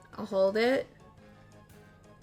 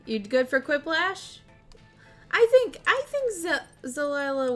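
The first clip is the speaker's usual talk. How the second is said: cute anime streamer; 7 semitones higher, 5 dB louder than usual. Real, not acted. Excited streamer pitching a game.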